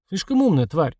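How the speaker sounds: ordinary delivery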